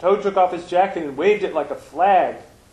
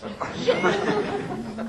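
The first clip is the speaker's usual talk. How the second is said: laugh. Real, not acted. Congregation opener, laughter.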